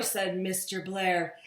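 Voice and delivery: ordinary delivery, nothing marked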